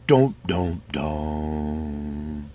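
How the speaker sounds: sung "dun, dun, dun", last one low and long; hammy suspense-music imitation; mock dramatic sting. Mock suspense sting after topic.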